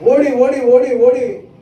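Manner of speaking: preacher voicing Hagar's frantic flight; urgent running. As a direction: drive the words like someone fleeing. Acting out Hagar running away.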